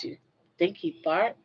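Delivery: usual speaking voice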